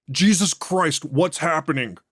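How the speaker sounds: frightened cry of pain; terrified agony. Acted. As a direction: panic as the body gives out. Convulsing, terrified.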